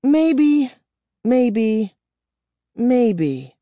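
high, loud, then fading mockery; glum repetition. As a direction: repeat it, sinking into gloom. She shook her head glumly.

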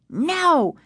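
high, emphatic refusal; insistent. A narrator's reading. Casey blocking his sister from the tendrils, insistent. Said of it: "Casey insisted".

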